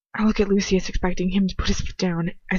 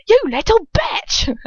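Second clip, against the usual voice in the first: high, loud, drawn-out roar in a put-on accent; Dolohov's accent; 7 dB louder, slower than usual. A narrator's reading. Reader retries the villain's line, roaring in the accent. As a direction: roar it slowly in the character's accent. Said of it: "Oh, I lost the accent".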